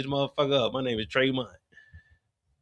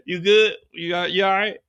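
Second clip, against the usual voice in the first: Rock, sucking up; 4 semitones higher than usual. Acted. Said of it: "asking Tremont for a handout".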